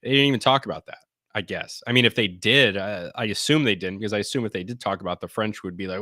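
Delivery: ordinary delivery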